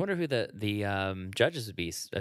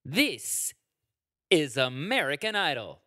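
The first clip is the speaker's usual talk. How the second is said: "This" set apart, then a pause; pause after This; 3 semitones higher than usual. Retold or imitated. Imitating the host's pause for a friend. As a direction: leave a dramatic gap after "This". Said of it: "That's the pause".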